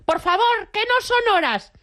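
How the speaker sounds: high, prim scolding from the balcony; prim lady yelling from balcony. Retold or imitated. Neighbour in a bathrobe scolds the noisy street party, high-pitched lady voice. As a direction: play the fussy señora telling off the street party. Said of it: "en posición de señora y grité".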